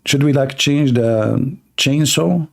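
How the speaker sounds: ordinary talk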